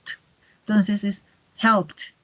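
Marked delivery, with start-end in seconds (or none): 0.0-0.1 "T" as the bare consonant sound. Shown as a demonstration. Teacher isolates the ending for students, a short voiceless "T". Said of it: "va a terminar con una T". 1.6-2.1 "helped" as one slow syllable ending in T; final T. Shown as a demonstration. Teacher models the rule, slow "helped" ending in T. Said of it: "va a terminar con una T".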